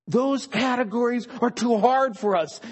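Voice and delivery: ordinary delivery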